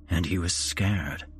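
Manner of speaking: plain reading voice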